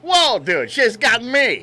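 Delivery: high put-on dopey husband voice; a hammed-up husband's voice; mock husband voice. Voicing husband's reply mockingly.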